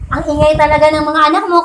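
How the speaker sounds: ordinary delivery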